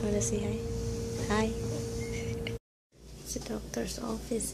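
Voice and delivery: soft whisper in a waiting room. Real, not acted. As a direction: hush it so others won't hear. Whispering in doctor's office.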